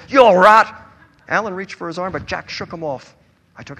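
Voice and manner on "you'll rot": menacing threat. A narrator's reading. Drunk man threatening Buzz.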